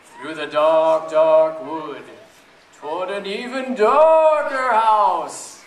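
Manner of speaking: ordinary delivery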